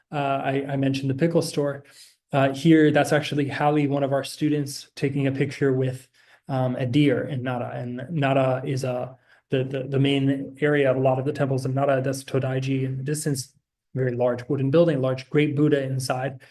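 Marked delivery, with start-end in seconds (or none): none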